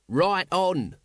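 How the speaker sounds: rowdy supporter's call, kept in check; shouted. A narrator's reading. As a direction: cheer them on with a mild shout. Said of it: "shouted Nobby".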